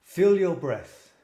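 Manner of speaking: slow, deliberate meditation voice; eyes closed, slow. Shown as a demonstration. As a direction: breathe the words out slowly. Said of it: "say it with my eyes closed".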